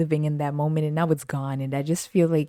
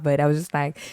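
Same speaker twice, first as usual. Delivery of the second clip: normal talking voice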